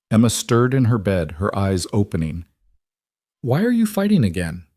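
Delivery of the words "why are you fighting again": put-on high, worried child; a small girl asking sleepily; little girl voice. A narrator's reading. Child wakes, asks parents.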